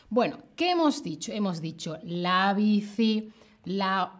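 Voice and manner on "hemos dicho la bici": ordinary talk, nothing marked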